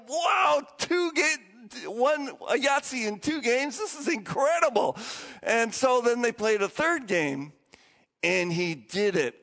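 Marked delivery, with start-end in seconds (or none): 0.1-5.0 amazed cry. Retold or imitated. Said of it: "They're like".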